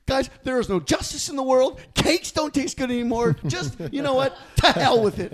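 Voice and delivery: high, melodramatic despair; despairing mom. Retold or imitated. Upset mom giving up before party kids, melodramatic. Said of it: "I was just like".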